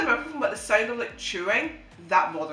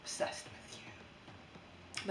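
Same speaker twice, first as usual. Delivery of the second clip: whispered, very slow; creepy whisper; 19 dB quieter, much slower than usual. Acted. Telling viewers how obsessed she gets, whispering. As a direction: breathe it like a stalker.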